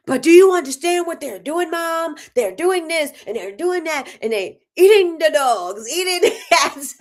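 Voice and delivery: high, loud, laughing son voice; imitating excited son. Son telling mom about dogs being eaten, high and laughing. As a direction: ham up the eager nephew. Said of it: "And I'm like".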